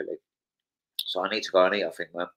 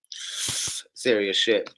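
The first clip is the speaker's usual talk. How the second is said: ordinary talk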